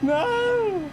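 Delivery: yelping no at the finish; high yelp of tension. Real, not acted.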